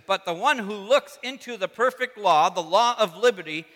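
loud, emphatic scripture reading; real, worked up. Real, not acted. Preacher finding his verse again, still worked up. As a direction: hammer the verse out with fervor. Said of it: "I'm getting all worked up".

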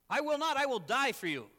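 loud, high, impassioned protest; fired-up vow. Acted. Peter swearing loyalty to Jesus, fired up. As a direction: insist on it with full force. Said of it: "he's so fired up".

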